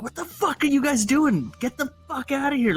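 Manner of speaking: high-pitched imitation of a furious guard; angry security chief. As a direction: play the outraged security head throwing them out. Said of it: "this head of security is like".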